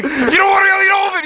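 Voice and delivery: high, loud holler; dad yelling. Acted. Dad grabs the whole cake from son, yelling. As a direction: shout it in a high, sustained voice.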